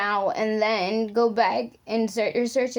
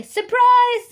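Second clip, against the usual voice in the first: high, loud party shout; shouted surprise; 13 semitones higher, 9 dB louder than usual. Acted. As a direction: shout it out as the guests leap up. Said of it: "they cried".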